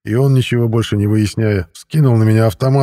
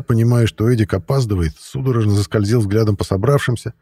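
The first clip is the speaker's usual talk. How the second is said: usual speaking voice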